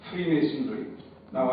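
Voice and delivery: usual speaking voice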